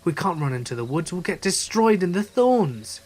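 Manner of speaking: rushed, rising to high and loud; panicked. Retold or imitated. Friends debating escape from the voice, panicking. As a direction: panic building as you talk.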